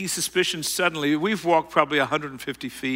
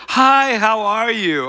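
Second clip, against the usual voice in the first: higher, slow and bright, pretending to know him; faked friendliness; 7 semitones higher, 5 dB louder, slower than usual. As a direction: bluff a cheery hello to a stranger. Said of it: "I thought I could fake it".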